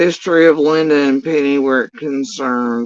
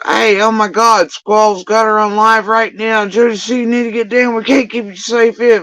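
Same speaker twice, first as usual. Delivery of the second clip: much higher, panicky mock warning; mocking frantic fans; 11 semitones higher, 3 dB louder than usual. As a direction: parrot the Discord crowd in a high, frantic voice. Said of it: "chasing them at certain points in the Discord".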